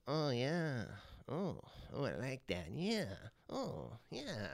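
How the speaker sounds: soft, drawn-out moans of approval; creepy dwarf moaning. Acted. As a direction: ooze quiet enjoyment, voice sliding up and down. Dwarf watching couple on bed.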